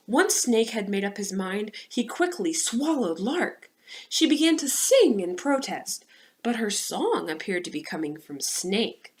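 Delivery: lively read-aloud storytelling voice; story narration. A narrator's reading. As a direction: tell it to a class of children. Reads children's story.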